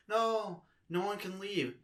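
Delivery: lightly voiced high-pitched protest; imitated drunk partygoer. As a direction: imitate her refusing to let anyone go. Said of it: "She's like".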